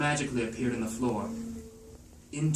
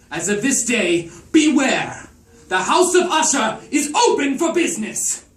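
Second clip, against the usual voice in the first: rising to a high, loud proclamation; triumphant shout; 12 semitones higher, 11 dB louder than usual. Acted. Stendhal to the clean-minded guests, a triumphant shout. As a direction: announce it like a showman gone mad.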